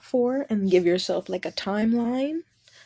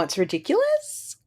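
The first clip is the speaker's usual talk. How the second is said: high indignant; 11 semitones higher than usual. Shown as a demonstration. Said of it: "repeats the comment two ways".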